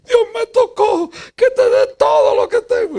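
very high put-on voice of a weeping man; tearful old man. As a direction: squeak it out like a teary donor. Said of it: "vino llorando y me dijo".

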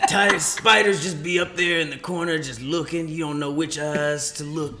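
lightly voiced stand-up riff; a hint of Tony Baker telling a spider joke; Tony Baker impression. Host tries a comedian impression for friends, lightly voiced. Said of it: "This is my impersonation, Tony Baker".

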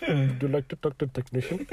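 put-on Indian accent. Agent offering a caller a technician, mock Indian accent. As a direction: play a polite mock Indian call-centre agent. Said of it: "that's how they sound".

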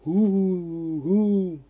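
long, loud, drawn-out ghostly "hu"; moaning skeleton. A narrator's reading. Skeleton to ghost-train riders, spooky moaning. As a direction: moan like a ghost-train skeleton, rising high. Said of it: "stöhnte das Skelett".

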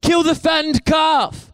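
high-pitched, stretched-out calling shout; shouting back. Retold or imitated. Father orders servants to celebrate his son, shouting back. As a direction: call out to someone far across the yard. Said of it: "he just shouts back to his house".

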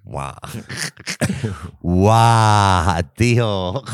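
gasping at a video; amazed wow; mock amazement. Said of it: "veo cosas que digo".

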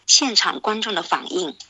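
ordinary delivery, nothing marked

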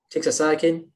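ordinary talk

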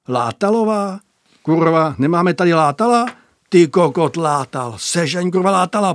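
loud, rough barracks shouting, cursing and demanding; loud crude command. A narrator's reading. Bogus orders the duty soldier to fetch Látal, loud rough shout. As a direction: bark the order at the room, loud. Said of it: "poručil Bogus".

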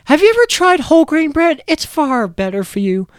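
mock health-nut lecture, high and loud; high mock cartoon girl voice. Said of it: "she's like".

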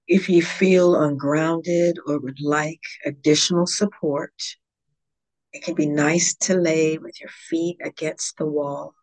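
calm, measured meditation guide with long pauses; guided yoga nidra. Real, not acted. Yoga teacher guiding listeners into yogic sleep, calm meditation voice.

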